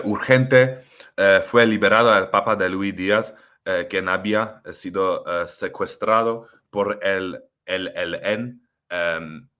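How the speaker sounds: Spanish read with native Spanish accent. Shown as a demonstration. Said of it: "I'll show you my Spanish accent".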